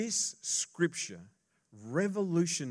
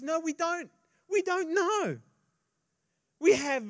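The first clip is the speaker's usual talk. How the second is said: much higher and louder, baffled disciple; Thomas protesting; 9 dB louder than usual. Acted. Thomas insisting to Jesus they don't know, high and loud. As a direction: cry it out like a lost, flustered disciple.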